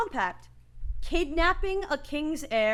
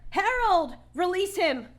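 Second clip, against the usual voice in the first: urgent higher call; 6 semitones higher than usual. Acted. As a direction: shout across the clearing to save him. Sister calls to captured brother.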